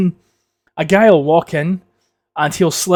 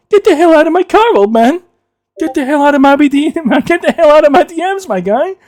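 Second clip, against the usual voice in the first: high-pitched, loud bully bellow; Biff Tannen; 11 semitones higher, 5 dB louder than usual. Retold or imitated. Movie bully ordering people out, loud high hammed-up yell. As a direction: yell it like a cartoonishly angry bully. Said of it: "Biff Tannen from, uh, Back to the Future".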